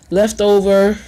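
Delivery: normal talking voice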